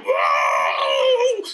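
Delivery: high, loud cartoon whoa; big startled monster holler; startled yell. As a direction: cry out as if knocked flying. Monster sent flying, startled.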